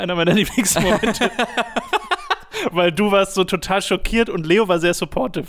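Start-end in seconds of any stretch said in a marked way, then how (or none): 1.4-2.7 high-pitched burst of laughter. Real, not acted. Recalling a funny moment.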